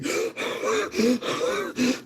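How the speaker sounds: desperate sharp gasp after being held under; big ragged gasp for breath; gasping for air. Acted. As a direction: gasp hard like surfacing from water.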